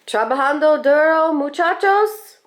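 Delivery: friendly dad hollering to the workers, stretched out; a hint of a proud father greeting his crew; cheerful papi greeting. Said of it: "my papi's voice touches everything".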